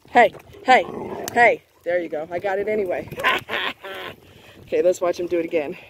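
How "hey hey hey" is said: sharp hey. Real, not acted. Dog won't release the stick.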